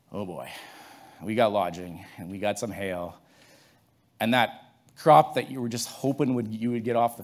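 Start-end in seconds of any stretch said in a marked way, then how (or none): 0.1-3.2 softer and lower, deflated; dismayed. Retold or imitated. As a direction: sigh it out as bad news sinks in. Farmer finds hail damage.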